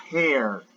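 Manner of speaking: hair said with an open E, "her" sound opened up; teacher's model of hair with open E; open-vowel hair. Shown as a demonstration. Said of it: "hair es una E abierta".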